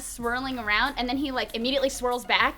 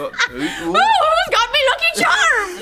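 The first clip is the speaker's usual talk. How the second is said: high, slow, laughing stage Irish; laughing mock leprechaun voice; mock Irish accent; 14 semitones higher, 7 dB louder, slower than usual. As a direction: ham up a cereal-box leprechaun. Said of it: "It was like that".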